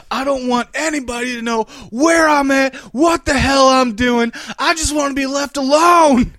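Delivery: hammed-up father demanding to be left alone; mock dad yell. Son voices dad wanting privacy.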